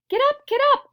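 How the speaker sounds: high, loud call; gleeful cry. A narrator's reading. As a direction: shout it out with delight. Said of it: "cried Wonka, roaring with laughter".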